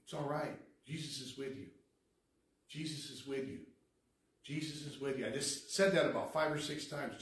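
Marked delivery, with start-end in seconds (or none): 0.0-5.3 soft, calming repetition; gentle reassurance. Retold or imitated. Speaker calming a tormented man by the dumpster, gentle reassurance. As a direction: soothe an agitated man, quietly and steadily.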